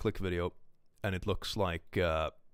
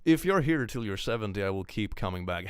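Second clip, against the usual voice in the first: usual speaking voice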